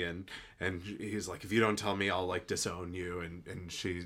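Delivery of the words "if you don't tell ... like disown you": ordinary delivery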